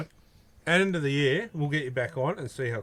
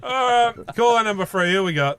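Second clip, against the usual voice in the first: announcer voice; 8 semitones higher, 7 dB louder than usual. Real, not acted. Radio host introduces next caller.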